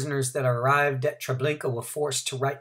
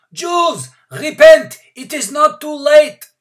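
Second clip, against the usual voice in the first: cried out; 16 dB louder than usual. A narrator's reading. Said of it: "cried out".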